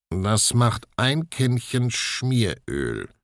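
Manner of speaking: high, very slow mechanical voice; a creaky little tin man asking for oil. Acted. Herr Spiegelei asks the sisters for oil, slow tin voice.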